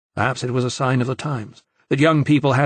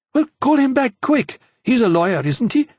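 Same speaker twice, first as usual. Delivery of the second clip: eager urging; 12 semitones higher, 4 dB louder than usual. A narrator's reading. Sophie urges eagerly.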